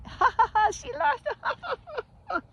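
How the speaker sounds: high, squealing fake cackle over the words; mock gloating laughter. Toxic people laughing at her downfall, high mock laughing voice. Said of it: "they'll be laughing".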